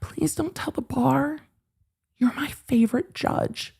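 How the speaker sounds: high, whispered wheedling plea; whispered pleading. Pretend. Mocking Ron whispering to the judge, begging softly. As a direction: beg in a whisper like a child.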